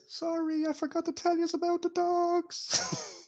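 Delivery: high, faint call carried from far off; a far-off voice drifting over the field; voice on the wind. Acted. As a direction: float it in from a distance, thin and high. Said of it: "I just hear on the wind is".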